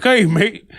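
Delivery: put-on a loud, high stab at an Irish accent that comes out Cockney; failed Irish accent. Mocking the Irish to a co-host, in a clumsy Irish accent. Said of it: "You can't do an Irish accent".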